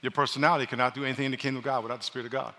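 ordinary delivery

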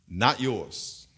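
ordinary talk, nothing marked

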